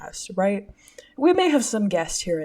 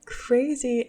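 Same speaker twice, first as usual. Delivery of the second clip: normal talking voice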